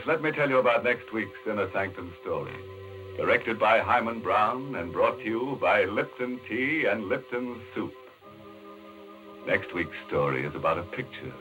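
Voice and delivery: normal talking voice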